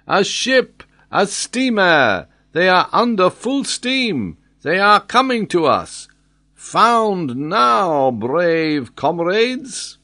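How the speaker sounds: high, stretched-out calling; excited report. A narrator's reading. Lookout reports approaching rescue ship.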